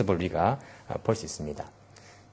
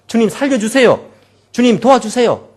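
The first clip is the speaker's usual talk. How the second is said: higher, louder pleading cry; loud prayer; 9 semitones higher, 7 dB louder than usual. Shown as a demonstration. Believers praying aloud in trouble, loud plea. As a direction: cry out to the Lord for help. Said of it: "목소리 높여 기도하는 것".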